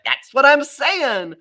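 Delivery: emphatic agreement. Retold or imitated. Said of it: "I went".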